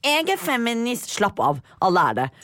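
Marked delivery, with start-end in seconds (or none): 0.0-1.1 mocking feminist. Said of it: "men ikke sånn du tenker å".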